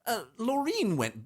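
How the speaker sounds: high, affected gossip; put-on high society voice; hammed-up snob. Continues the snob bit.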